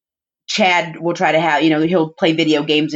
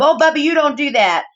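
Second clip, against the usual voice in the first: put-on high bossy toddler scold; scolding little sister; 9 semitones higher than usual. Little sister scolding her brother, high and bossy. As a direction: play the three-year-old tattling on him.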